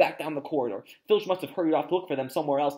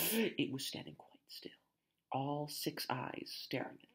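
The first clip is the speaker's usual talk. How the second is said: soft, breath-held suspense telling; hushed narration; 11 dB quieter than usual. A narrator's reading. Narrator describing the monster watching the children, hushed. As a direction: drop to near whisper for dread.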